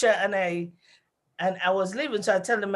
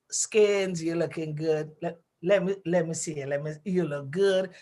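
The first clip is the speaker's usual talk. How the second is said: flirtatious brother; 5 semitones lower, 4 dB quieter than usual. Retold or imitated. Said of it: "My brother does the same thing".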